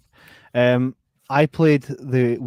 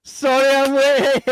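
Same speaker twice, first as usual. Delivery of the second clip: pretend latecomer; 14 semitones higher, 10 dB louder, slower than usual. Pretends Janice arrives late.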